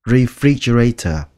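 usual speaking voice

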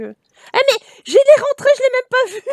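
high, loud squeal breaking into laughter; shrieky surprise tipping into a laugh; real startled outburst. Real, not acted. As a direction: burst out amazed, voice shooting up. Startled by unnoticed event in game, laughs.

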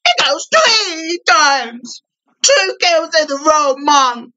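slow, very high gloating sing-song; high squealing glee. Real, not acted. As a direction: squeal the kill count out slowly. Gleeful kill streak squeal.

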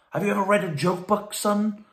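hammed-up doctor quizzing the sad patient; mock doctor. Doctor voiced in a joke.

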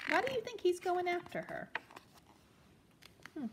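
usual speaking voice

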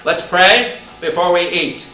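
a hint of Father Bill's call. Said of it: "Father Bill says".

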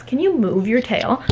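normal talking voice

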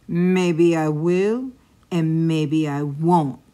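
slow, loud, drawn-out monster taunt; teasing chupacabra. A narrator's reading. Chupacabra teases the goat, slow loud taunt. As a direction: toy with the little goat.

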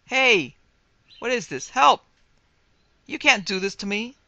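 high, loud terrified yells; panicked screaming. A narrator's reading. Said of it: "I screamed".

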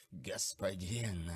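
slow, hushed undead croak; a rotting ghoul forcing words out; ghoulish rasp. Acted. Rotting servant tries to speak. (хриплый голос мертвеца)

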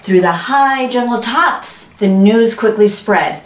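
ordinary delivery, nothing marked

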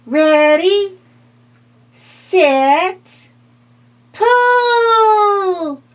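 high, loud rallying call. Acted. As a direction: call the team like a tiny coach. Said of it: "called the bat".

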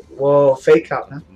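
ordinary talk

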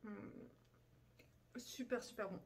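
talking while chewing food; muffled mid-bite speech; mouth full, chewing. Real, not acted. Seen 0: Bites cheese toast at -7; lips closed, chewing through words.

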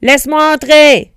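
loud, high commanding shout; forceful storybook yell; shouting wolf. A narrator's reading. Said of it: "cria le loup".

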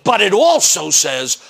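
ordinary delivery

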